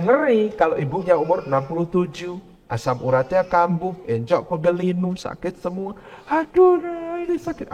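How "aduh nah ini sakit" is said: put-on high, pained old mother's complaint; a hint of an aching old woman. Elderly mother to grown child, high pained voice.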